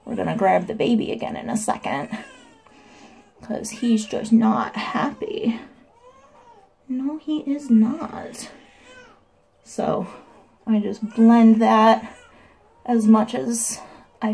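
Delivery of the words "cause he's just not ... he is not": slow whispered aside. Real, not acted. Mom whispering about unhappy baby, slow whisper. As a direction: murmur it softly and slowly.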